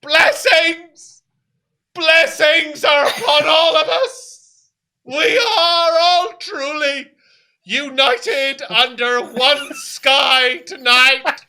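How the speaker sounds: high, loud, drawn-out proclamation; crazed preacher's booming blessing; ecstatic zealot old man. Acted. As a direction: cry it to the heavens, very slowly. Old man proclaims, hands raised.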